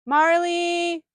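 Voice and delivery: high, loud call across the park; calling the dog. Real, not acted. Calling out for her lost dog Marley, a loud high call. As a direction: shout her name to bring her back.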